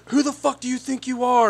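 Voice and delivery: imitated angry challenge, high without shouting; irate shopper. Shopper challenging a store worker, mock irate. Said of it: "he's yelling and cussing".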